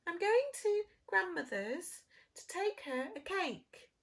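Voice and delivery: put-on high, bright-eyed little girl. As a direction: sing it out like a cheerful child.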